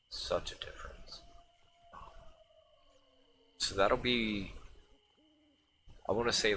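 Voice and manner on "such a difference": breathy unvoiced whisper, softer. Shown as a demonstration. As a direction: whisper it to yourself.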